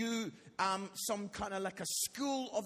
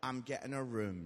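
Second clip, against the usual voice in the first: low, softer matter-of-fact reply; understated teenage indifference; imitating son; 9 semitones lower, 6 dB quieter than usual. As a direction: play the unbothered son, low and quiet. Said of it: "And he is like".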